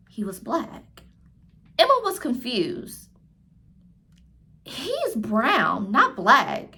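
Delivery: clear, lively read-aloud voice for a class; reading to children. A narrator's reading. Teacher reading a picture book aloud to her class, lively storytelling. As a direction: tell the story warmly to young listeners.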